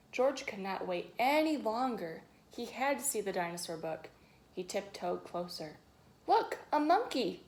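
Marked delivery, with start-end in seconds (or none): 6.3-7.4 put-on high excited shout of a little girl. A narrator's reading. Girl in story hour shouting about the monkey. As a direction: call out like a startled kid. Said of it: "shouted a girl".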